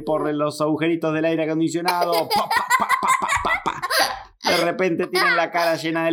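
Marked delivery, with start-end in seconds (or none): 2.3-3.8 very high rapid pa sounds, laughing; squeaky cartoon spray; seed spitting noises. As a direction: mimic seeds spraying out. Seeds shooting from vents.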